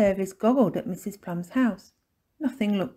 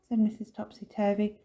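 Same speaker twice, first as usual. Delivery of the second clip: usual speaking voice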